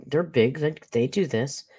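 ordinary talk

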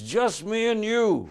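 loud, slow, high Scottish bellow; huge Scotsman inviting him to a ceilidh, Scottish boom; big Scotsman. Retold or imitated. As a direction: boom it out like a giant in a kilt. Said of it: "He says".

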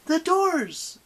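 high, loud shout of warning; urgent warning. A narrator's reading. Annabeth warns Percy as the doors slide apart, urgently. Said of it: "she warned".

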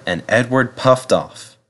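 usual speaking voice

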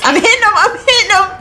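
high, laughing triumph; excited cry. Real, not acted. Streamer landing skill checks under pressure, high thrilled cry. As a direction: squeal it, thrilled.

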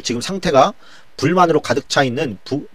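usual speaking voice

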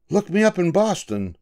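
high, bright-pitched stranger's farewell; high lightly voiced passenger. A narrator's reading. A passenger says goodbye to Julius after the trip, pitched high. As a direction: toss it off like a cheerful traveller.

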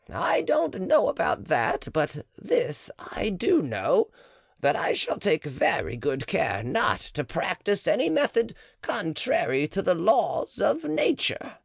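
lightly voiced cautious midwife; the matron. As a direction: answer warily, keeping your distance.

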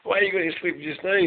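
normal talking voice

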